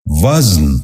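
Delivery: وزن said as one syllable, "vazn"; correct pronunciation. Shown as a demonstration. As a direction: run z straight into n in وزن. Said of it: "درست تلفظ ہے".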